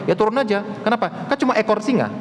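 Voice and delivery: teasing riddle answer; riddle punchline. Acted. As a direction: deliver the joke answer brightly.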